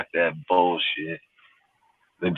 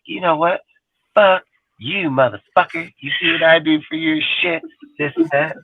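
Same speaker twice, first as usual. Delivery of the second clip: high-pitched mock tirade; angry girlfriend; 10 semitones higher than usual. Girlfriend cussing out her man in a fight, angry high mock voice. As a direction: imitate her cussing him out, much higher.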